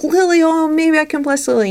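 high, loud eager inner voice; bright hopeful little exclaim, pitched well up; eager hopeful thought. Retold or imitated. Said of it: "I'm like".

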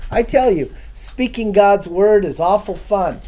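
ordinary delivery

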